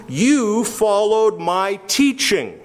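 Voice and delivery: "you" stressed, the whole line slow and deliberate. Shown as a demonstration. Paul urging Timothy, slow, with "you" stressed. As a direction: lean on "you" and draw the line out. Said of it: "the emphasis is on the you".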